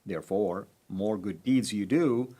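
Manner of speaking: ordinary talk, nothing marked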